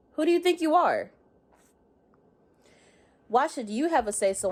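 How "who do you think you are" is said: high-pitched confrontational demand; indignant challenge. Acted. Critic puts a woman in her place, indignant challenge. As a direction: throw it out sharply, pitch jumping up.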